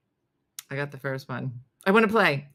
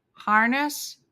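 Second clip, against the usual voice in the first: usual speaking voice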